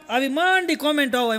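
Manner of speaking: ordinary talk, nothing marked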